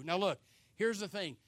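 ordinary delivery

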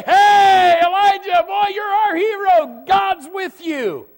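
high, loud crowd cheer, slowing down. Retold or imitated. The crowd hailing Elijah as their hero, high loud shout. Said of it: "everybody would say".

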